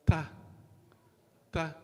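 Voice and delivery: "ta" with the tongue tip on the gum line behind the front teeth; correct ta. Shown as a demonstration. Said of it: "Just on the gum, gum line".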